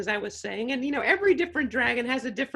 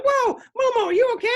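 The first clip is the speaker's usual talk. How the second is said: mock alarmed; 10 semitones higher, 6 dB louder, faster than usual. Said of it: "I was like".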